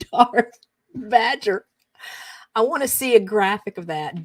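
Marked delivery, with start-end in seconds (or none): 0.0-1.6 slow, broken up by laughter; amused. Real, not acted. Host relishing a silly autocorrect, laughing. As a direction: laugh through the name, drawing it out. Cracking up at the misread name.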